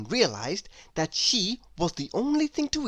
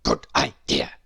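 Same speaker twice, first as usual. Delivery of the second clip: much lower grunting pig. A narrator's reading. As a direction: say it like a deep dim pig. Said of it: "said the pig".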